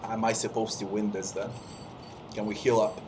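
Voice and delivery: ordinary delivery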